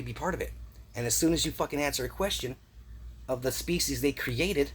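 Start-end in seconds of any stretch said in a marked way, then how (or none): none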